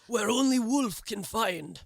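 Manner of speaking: slow, hammed-up fake Russian accent; a clumsy mock Russian accent, drawn out; bad Russian accent. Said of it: "That was a terrible accent".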